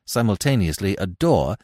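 plain reading voice

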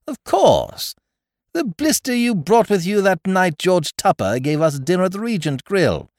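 high, loud burst of recognition; sudden recollection. Acted. Visitor suddenly remembers the barmaid, exclaiming. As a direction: exclaim as it all comes back. Memory of a woman awakes.

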